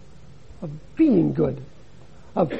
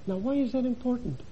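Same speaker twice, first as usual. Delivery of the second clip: normal talking voice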